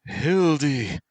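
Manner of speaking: high, loud cry of the name; passionate cry. Acted. Dreamer cries out his lover's name in passion. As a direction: call her name out in passion. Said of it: "He called out her name in passion".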